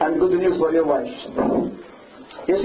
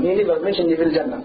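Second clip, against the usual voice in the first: ordinary talk, nothing marked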